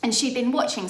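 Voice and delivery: normal talking voice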